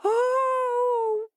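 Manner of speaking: high, loud crying wail. A narrator's reading. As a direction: let out a frightened wail. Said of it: "wailed Hare".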